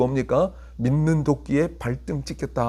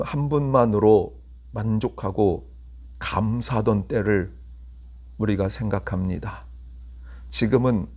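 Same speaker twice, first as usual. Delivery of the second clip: prayer; 6 semitones lower, 4 dB quieter than usual. Acted. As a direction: pray humbly and quietly. Closing prayer.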